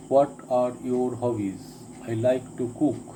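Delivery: ordinary talk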